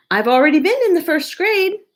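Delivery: high, quick teasing reply; teasing mother. Acted. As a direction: brush it off playfully like a mother. Mother refuses homework.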